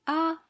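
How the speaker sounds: ah held high and level, a falsetto-like note; first tone. Shown as a demonstration. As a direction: sing ah on one high, flat pitch. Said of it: "So the first tone is the high tone".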